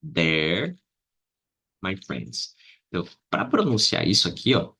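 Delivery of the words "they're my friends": They're joined to my friends in one flow; contraction demo. Shown as a demonstration. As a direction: say "They're" like "there". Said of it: "Vou juntar aqui".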